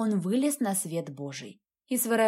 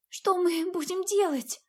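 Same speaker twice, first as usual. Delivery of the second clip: put-on high, slow scared child; frightened girl; 9 semitones higher than usual. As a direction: ask it fearfully, higher and slower. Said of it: "испуганно спросила девочка".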